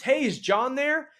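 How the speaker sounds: high, upbeat, slow greeting; excited. Shown as a demonstration. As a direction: sound thrilled to reach an old friend. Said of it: "I'm gonna say it as if I'm excited".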